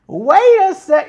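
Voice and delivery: high, loud, slow Staten Island snark; imitating Liv interrupting Charlotte, Staten Island accent; snarky Staten Island. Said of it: "snarky-ass, high-pitched Staten Island".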